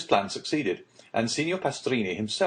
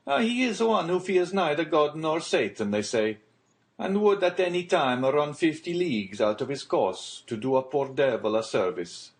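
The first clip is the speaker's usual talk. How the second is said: higher, lively voice for the boastful sailor; Gaetano the sailor; 5 semitones higher than usual. A narrator's reading. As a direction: raise the voice for Gaetano, admiring his master.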